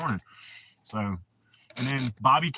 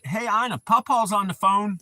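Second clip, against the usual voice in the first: put-on raised, higher voice calling to a child; imitated calling out; 7 semitones higher, 6 dB louder than usual. Mother telling child on a swing about Papa's call, imitated calling out. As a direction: call it out as if retelling an excited announcement. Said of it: "And she was like".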